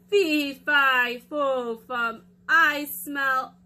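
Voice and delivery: loud, slow, drawn-out giant chant. Acted. Giant sniffing for Jack, loud and slow.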